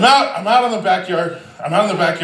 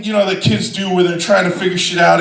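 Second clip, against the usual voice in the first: usual speaking voice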